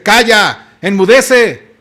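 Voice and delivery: loud, firm command to the sea; commanding rebuke. Acted. Jesus standing up to silence the storm, loud command.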